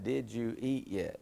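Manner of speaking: slow, careful "Did you eat yet?"; teacher spelling out a slurred phrase; spelled out slowly. Shown as a demonstration. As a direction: unpack each word slowly. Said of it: "Think about it".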